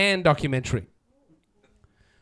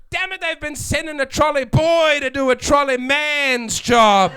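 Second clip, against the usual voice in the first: angry shout; 5 semitones higher than usual. Retold or imitated. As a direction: bellow it like a fed-up shopper. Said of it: "Boy, I get angry".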